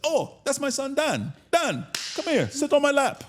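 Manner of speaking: high, excited imitation of Dad's accent; father's accent. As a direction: call the son over in Dad's high accented voice. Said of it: "that, that accent is fly".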